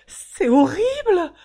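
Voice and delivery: high, loud anguished cry; sobbing wail. Acted. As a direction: break into sobs. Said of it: "gémit-elle en éclatant en sanglots".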